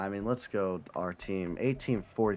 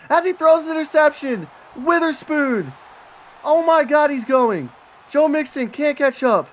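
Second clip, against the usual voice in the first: much higher and louder frantic play-by-play; excited shouting; 11 dB louder than usual. Real, not acted. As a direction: yell the turnover live as it happens. Live play call of interception.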